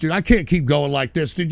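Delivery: ordinary delivery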